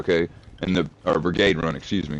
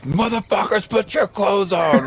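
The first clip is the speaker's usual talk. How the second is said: mock drill sergeant; 12 semitones higher than usual. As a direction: imitate a yelling sergeant. Sergeants yelling at drunk soldiers.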